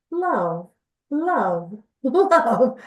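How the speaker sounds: repeated, drawn-out chant of love; imitating inner voice. Telling a friend how the word looped in her head, drawn out. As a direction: echo the word like it loops in your head. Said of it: "It sounded like this".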